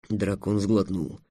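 usual speaking voice

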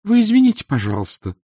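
put-on high-pitched, tearful-tinged girl; apologetic girl. A narrator's reading. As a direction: plead in a high young voice. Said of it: "обратилась она ... и всхлипнула".

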